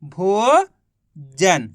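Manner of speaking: भोजन stretched very slowly as one word; very slowly joining भो and जन; drawn-out blend. Shown as a demonstration. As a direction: draw out भोजन long and slow. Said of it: "तो मिलके बन गया".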